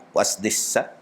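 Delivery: low, quiet officer asking; a soft, polite customs query; officer's question. Retold or imitated. Customs officer questions the preacher, quiet and low. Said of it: "Dia tanya pula".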